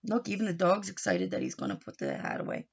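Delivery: ordinary delivery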